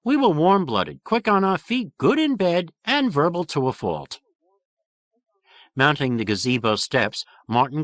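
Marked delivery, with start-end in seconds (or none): 0.0-4.2 higher, brash New York dinosaur; endearing New York accent, bragging. A narrator's reading. Male dinosaur boasting to explorers in New York accent. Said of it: "nasal but endearing New York accent".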